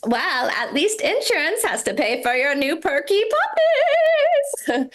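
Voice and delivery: high, slow, hammed-up British accent; mock British, drawn out and high-pitched; British sister-in-law. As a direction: imitate a British woman, stretching it up into a high operatic finish. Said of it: "my British sister-in-law was so funny".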